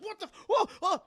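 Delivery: high, loud broken-off yelps; hammed-up alarm, cut short; mock panic. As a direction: yelp as if being tossed about. Man bucked on shaking bed.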